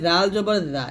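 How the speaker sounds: "জাল জবর জা" with a light th-like z; tongue tip on upper teeth for a thin "জা". Shown as a demonstration. Teacher introducing the thin letter, light "জা". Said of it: "এটা পাতলা হবে".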